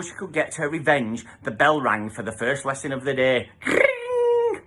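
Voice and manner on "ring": ordinary delivery, nothing marked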